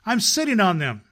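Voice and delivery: insistent cry. A narrator's reading. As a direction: plead the point hard. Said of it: "cried the little rabbit".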